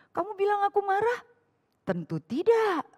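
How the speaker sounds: high, huffy mock denial with glaring eyes. Angry wife insists she's not angry, mock glaring. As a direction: glare and deny it all. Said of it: "Sambil melotot gitu ya".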